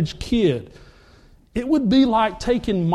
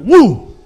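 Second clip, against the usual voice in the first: mock whoosh cry; 4 semitones higher, 11 dB louder than usual. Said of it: "just turned and went".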